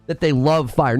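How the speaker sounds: normal talking voice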